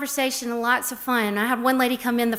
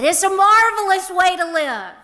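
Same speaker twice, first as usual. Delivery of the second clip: high, louder mock shout; retold shout; 8 semitones higher, 6 dB louder than usual. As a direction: call out joyfully from the back. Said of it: "just shouted from the back of the room".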